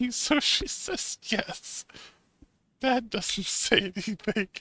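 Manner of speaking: laughing while reading. Real, not acted. Laughing while reading game text.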